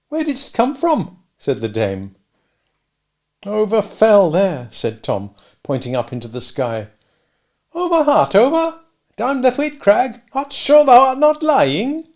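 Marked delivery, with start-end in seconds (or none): 0.1-1.2 put-on high kindly old dame voice; a cottage dame fussing over a boy. Old dame offers the sooty boy milk, high and kindly. 3.4-4.7 put-on higher, slower boy's voice; a tired little sweep answering; Tom's voice. Tom answers the dame, slow and tired. 7.7-12.1 put-on high, loud amazed old dame; old dame astonished. Old dame questions the boy in loud amazement.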